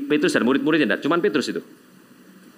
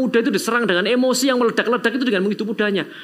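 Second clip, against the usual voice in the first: preaching at full force; 8 semitones higher than usual. Acted. Preacher warning the young people at full force. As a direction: press the warning home to them.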